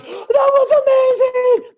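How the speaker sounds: very high, drawn-out loud cheer; slow, squealed rave from the stands; cheering crowd. Acted. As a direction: whoop it out like a thrilled audience. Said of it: "everybody just goes".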